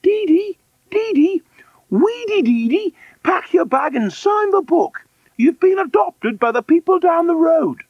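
very high, loud matron's call; sustained high-pitched summons. Acted. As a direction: holler out of the window to a child. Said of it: "and called".

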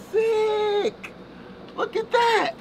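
high delighted cry; thrilled at the lever; excited. Real, not acted. The wiper lever works.

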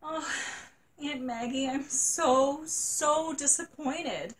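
put-on high, stretched, sobbing little girl. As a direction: wail it out slowly like a heartbroken child. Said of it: "sobbed Janie".

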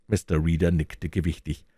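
ordinary delivery, nothing marked

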